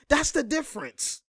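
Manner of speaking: "That's the difference." lifted high and dropped soft, teasing. Shown as a demonstration. Commentator teases critics with a high, soft repeat. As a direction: echo it high and soft, taunting.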